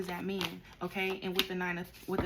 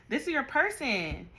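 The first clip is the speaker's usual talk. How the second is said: slower, louder, higher "This is your person"; emphatic repeat; 5 semitones higher, 6 dB louder, slower than usual. Real, not acted. Tarot reader stressing to Capricorn who their person is. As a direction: lean in hard on it.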